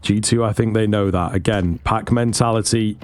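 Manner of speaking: ordinary talk, nothing marked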